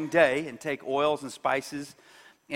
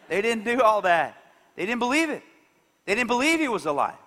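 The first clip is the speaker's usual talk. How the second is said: normal talking voice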